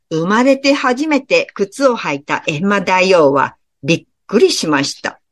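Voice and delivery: plain reading voice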